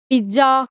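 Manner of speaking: पिज्जा said "pij-ja"; wrong "pizza" with j. Shown as a demonstration. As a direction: put a j sound in पिज्जा. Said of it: "इसको बोलते हैं".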